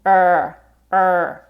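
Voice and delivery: a drawn-out American R, tongue back; held R sound. Shown as a demonstration. Teacher shows the learner the R sound. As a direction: hold a long "er". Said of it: "neither touching the top or bottom of your mouth".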